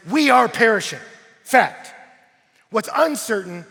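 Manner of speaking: loud, high, emphatic preacher delivery; full-force preaching. Acted. Preacher retelling disciples in a storm, full-force preaching. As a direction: drive each point out to the crowd.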